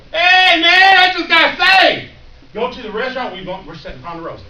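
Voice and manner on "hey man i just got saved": very high, loud, slowed shout; joyful shout. Retold or imitated. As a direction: yell it to everyone leaving church. New convert yelling outside church.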